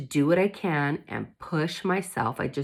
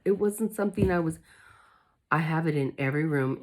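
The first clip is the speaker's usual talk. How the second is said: usual speaking voice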